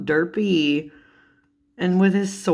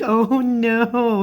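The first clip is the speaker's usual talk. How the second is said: higher, drawn-out amused lament; mock dismay; 6 semitones higher, 3 dB louder than usual. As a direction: wail it lightly, fresh from a laugh. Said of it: "I'm like".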